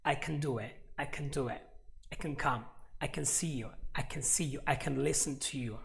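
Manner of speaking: "can" squeezed to "c'n", its A nearly gone; reduced "can". Shown as a demonstration. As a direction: drill short, unstressed "can" before each verb. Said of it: "this A just disappears".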